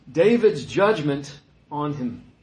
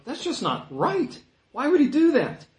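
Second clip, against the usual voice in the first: higher, indignant "why would he do that"; indignant outburst; 10 semitones higher than usual. Retold or imitated. Congregation reacting to the rich man: higher indignant protest. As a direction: voice the listener's shocked outrage. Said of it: "all of us would go".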